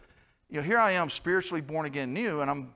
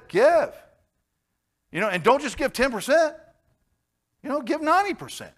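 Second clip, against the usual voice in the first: emphatic urging; 12 semitones higher, 6 dB louder than usual. Real, not acted. Urging congregation to give freely.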